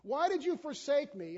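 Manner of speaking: loud, high accusing cry; complaining to God. Retold or imitated. Believers blaming God, a loud complaining cry. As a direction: demand an answer from God. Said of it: "we look back and we say".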